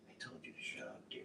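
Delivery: hushed, breathy scolding; whispered. Retold or imitated. Wife in the chair whispering at the barking dog. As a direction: whisper it as a smug aside. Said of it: "Libby's just sitting in the chair like".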